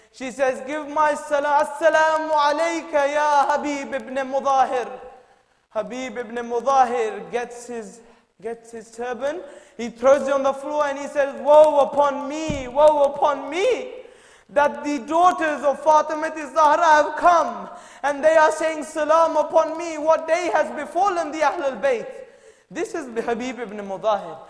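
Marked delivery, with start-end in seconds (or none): 0.7-4.9 loud, higher ringing call across the camp; impassioned cry of greeting; Zainab's called greeting. Retold or imitated. Zainab calls greeting to Habib. 11.4-22.1 anguished lament. Retold or imitated. Habib laments, throws turban down.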